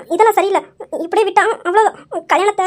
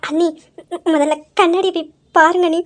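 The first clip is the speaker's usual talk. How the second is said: normal talking voice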